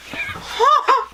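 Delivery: high breathy burst after a scare; real jolt, gasping into a laugh; high startled laughter. Real, not acted. Really startled by brother.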